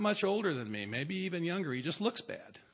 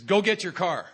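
"Go get your car." barked higher and louder; curt order; 4 semitones higher, 7 dB louder than usual. Retold or imitated. The sponsor ordering the newcomer, curt bark. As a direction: give it as a flat command, no please. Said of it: "Well, he says".

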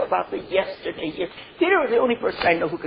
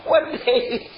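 higher voice bubbling with laughter; laughing; 7 semitones higher than usual. Real, not acted. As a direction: tell it through giggles. Laughing recalling their promise.

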